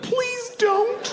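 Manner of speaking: put-on tiny, very high pleading baby voice. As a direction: squeal it like a baby begging. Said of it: "And the baby's like".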